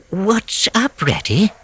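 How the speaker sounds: high small voice; curious and fresh; mole's voice. A narrator's reading. Mole asking the rat at the entrance, small voice.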